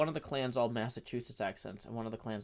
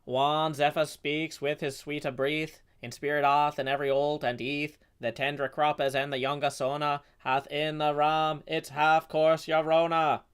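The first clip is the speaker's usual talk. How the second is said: Scottish accent; 3 dB louder than usual. Shown as a demonstration. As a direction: Middle English verse in a Scottish accent. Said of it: "think of literally anything else I could say with this accent to demonstrate".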